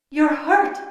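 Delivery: high, alarmed exclamation; despairing cry. A narrator's reading. Dragon seeing her injured rider, high alarmed cry. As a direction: cry out in dismay. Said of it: "Talent cried in despair".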